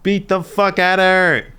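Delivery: high, very loud holler; excited yell. Real, not acted. Viewer yelling at the fight on screen, excited shout. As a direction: yell it at full volume.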